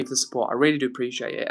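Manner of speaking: ordinary delivery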